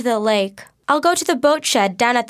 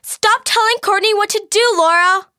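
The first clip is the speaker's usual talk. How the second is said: shouted. Acted. Kat shouting at Laura for bossing Courtney, much higher angry shout. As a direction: yell at her to back off. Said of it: "she shouted".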